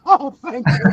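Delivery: giddy mock gratitude, laughing; laughing relief. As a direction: laugh out a relieved thank you. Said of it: "I'm like".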